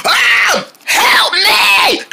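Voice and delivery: high panicked scream; screaming for help. Acted.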